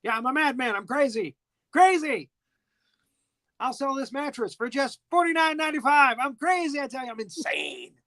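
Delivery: high-pitched hammed-up madman; mock lunatic sales pitch; playing crazy. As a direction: rave like a crazy mattress salesman. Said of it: "plays the crazy".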